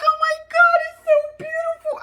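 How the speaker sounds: high, squealing teary gush; mock gushing Eve. Host mimics Eve gushing about the venue, high mock squeal. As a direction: gush over it like a giddy bride. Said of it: "She's like".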